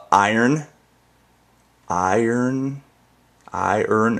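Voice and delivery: "iron" said as "I earn", two clear syllables; own "iron". Shown as a demonstration. Showing viewers how she says "iron", like "I earn". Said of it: "It's like I earn".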